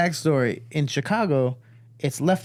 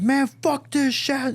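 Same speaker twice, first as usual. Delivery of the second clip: high, slow furious rant; mad, dragging the words; super mad; 11 semitones higher, slower than usual. Retold or imitated. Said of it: "just super mad".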